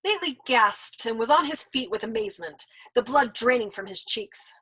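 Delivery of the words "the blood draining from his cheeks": ordinary delivery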